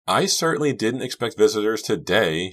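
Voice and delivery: a small, startled woman's voice; tiny voice. A narrator's reading. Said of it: "She had a tiny voice".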